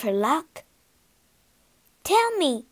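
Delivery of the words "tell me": much higher, eager plea; excited begging. Acted. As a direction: beg with rising excitement. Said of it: "she begged excitedly".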